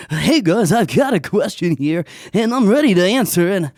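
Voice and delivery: loud, hammed-up TV presenter voice; mock TV host. Podcaster mocking shy callers as a TV host, hammed-up. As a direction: ham it up like a cheesy TV show host. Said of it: "talk like everyone else on T V".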